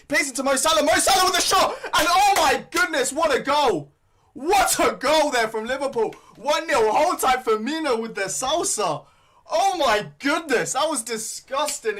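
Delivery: loud, high, thrilled commentator yell; oh my goodness, roared out at the top of the voice; goal celebration. Real, not acted. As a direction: scream it like the ball just hit the net. Fan watches Salah score.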